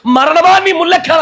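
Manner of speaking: high, loud taunt at death; defiant shout. Acted. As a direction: challenge death triumphantly at full voice. (మరణాన్ని సవాలు చేస్తూ గట్టిగా అరవడం)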